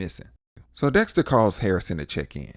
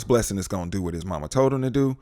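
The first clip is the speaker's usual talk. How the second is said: ordinary delivery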